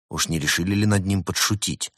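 ordinary delivery, nothing marked

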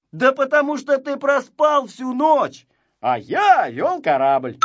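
high, loud comeback in a squabble; self-justifying retort. A narrator's reading. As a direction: fire back, claiming your due. Quick retort in a squabble over naming.

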